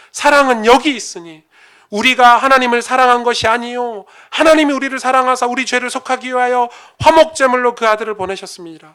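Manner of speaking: loud, high, forceful scripture reading; booming pulpit reading of the Bible; preaching scripture. Acted. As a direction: proclaim the verses at full force.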